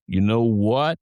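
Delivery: very slow low Texan drawl. Shown as a demonstration. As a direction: ease way down, slow and sexy. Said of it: "real low and slow".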